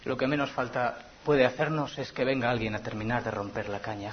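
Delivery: ordinary delivery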